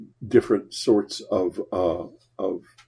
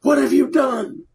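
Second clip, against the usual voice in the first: yelling. Retold or imitated. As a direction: call up the stairs in alarm. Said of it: "my mother and the maid both yelling".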